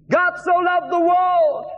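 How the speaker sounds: high, slow, drawn-out yell; street-preaching shout. Retold or imitated. New evangelist trying the verse outside a pub, shouting. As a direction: holler it out for everyone to hear. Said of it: "Okay, try again".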